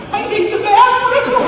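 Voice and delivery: high, slow, loud whimper; hammed-up frightened squeak; scared squeak. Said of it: "walking around my bedroom going".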